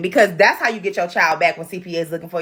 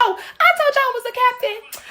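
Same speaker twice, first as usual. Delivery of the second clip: mock bragging Centipede; 14 semitones higher than usual. Centipede bragging about being captain.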